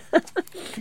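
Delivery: short, high laugh; rueful laugh. Real, not acted. Farmer making light of what happens to him, rueful laugh. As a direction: laugh it off briefly. Laughing over own bad luck.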